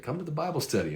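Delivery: high-pitched mock southern accent. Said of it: "hence the southern accent".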